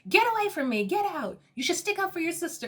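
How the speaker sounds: mock-indignant quote, high and hurried; retold angry outburst.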